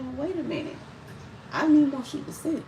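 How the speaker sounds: high-pitched, stunned protest; retold shocked disbelief. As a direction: relive the disbelief, voice pitched right up. Reacting to news of cousin's death.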